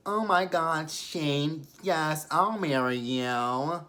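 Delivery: high, drawn-out mocking voice; Lacey impersonation. Lacey accepting Shane's proposal, mocking high-pitched gush. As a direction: gush in a high put-on voice, stretching the last words. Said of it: "you guys get Lacey's impersonation".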